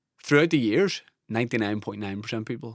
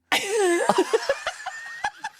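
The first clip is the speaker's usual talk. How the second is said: a long, high laugh; high burst of laughter. Real, not acted. Guest laughing at his own celebrity blunders, high laugh.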